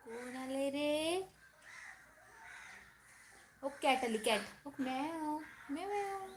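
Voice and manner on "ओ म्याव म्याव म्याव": put-on playful high meows to the toddler; soft mock meow; meowing like a cat. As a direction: mew like a kitten to catch his eye.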